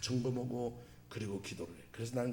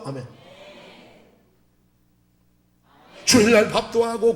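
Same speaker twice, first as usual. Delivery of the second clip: preaching at full force; 8 semitones higher, 9 dB louder than usual. Real, not acted. Preacher rousing the congregation, loud fervent preaching. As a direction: proclaim it to the whole congregation.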